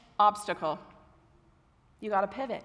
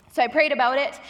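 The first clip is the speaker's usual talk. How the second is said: ordinary talk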